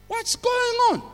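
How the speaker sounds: very high-pitched, drawn-in puzzlement, acted out; mock bewilderment way up high; baffled exclamation. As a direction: squeal the puzzled thought to yourself. Acting out his own puzzled thought.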